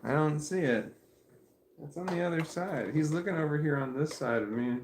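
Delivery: high-pitched dog talk; pet-talk voice. Real, not acted. Owner helping dog find the ball, high playful pet talk. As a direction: lift the pitch as if chatting to a pet.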